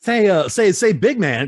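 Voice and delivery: high, loud put-on persona; Stain character voice. Shown as a demonstration. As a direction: push it up high and loud, hammed-up. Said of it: "I gotta talk like that".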